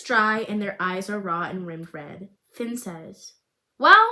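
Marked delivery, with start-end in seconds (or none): 3.8-4.1 playful. Acted. Finn jokes to break tension.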